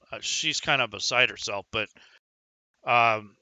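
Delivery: ordinary talk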